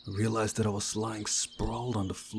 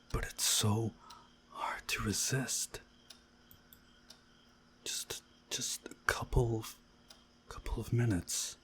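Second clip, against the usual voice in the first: drowsy whisper; 6 dB quieter than usual. Acted. Dozing off on night watch.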